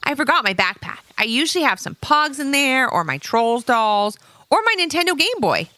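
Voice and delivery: put-on high, eager little-boy voice; little boy Eric. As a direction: chirp it like a friendly young kid. Eric speaks.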